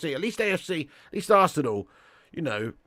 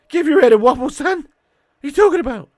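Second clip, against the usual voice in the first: much higher, much louder telling-off; exasperated shout; 12 semitones higher, 14 dB louder, faster than usual. Real, not acted. Streamer scolding Cake Cadet in chat, exasperated shout. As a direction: bellow it at the chatter to snap out of it. Arguing with a chatter.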